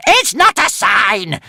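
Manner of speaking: high, loud, drawn-out squeal; squealing witch. A narrator's reading. Witch denies the crowd's omen, squealing. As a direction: squeal the denial slowly and loudly. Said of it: "squealed the witch".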